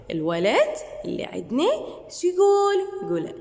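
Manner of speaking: ordinary talk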